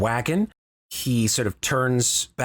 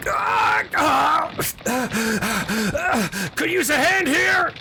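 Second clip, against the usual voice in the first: high, strained yell over the racket; pained yell; 12 semitones higher, much slower than usual. Acted. Wounded Belton yelling back to the hidden party for help. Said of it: "he yells backwards to you".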